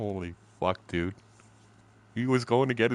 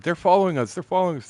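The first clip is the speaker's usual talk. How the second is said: mock paranoid; 9 semitones higher, faster than usual. Paranoid buddy fears being followed, high mock worry. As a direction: ham up the friend's jumpy fear. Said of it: "John was all paranoid".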